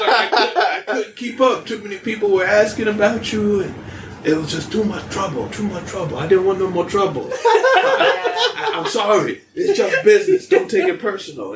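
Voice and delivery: put-on high-pitched apologetic old man, laughing. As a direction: whine it out like a sheepish old shopkeeper. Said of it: "He's like, he's like".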